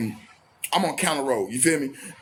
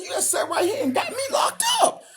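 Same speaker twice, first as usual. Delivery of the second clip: much higher, laughing through the accusation; laughing disbelief. Retold or imitated. To the girlfriend who snitched, high bitter laughing.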